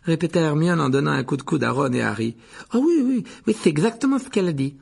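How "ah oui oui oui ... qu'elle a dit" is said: put-on higher, louder, hurried-to-agree girl's voice; over-keen reassurance; eager agreement. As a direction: agree emphatically, pitched up. Hermione plays along with a fib.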